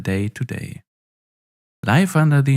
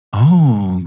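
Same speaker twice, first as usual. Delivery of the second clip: delighted oh; 7 dB louder than usual. Acted. General's delighted exclamation.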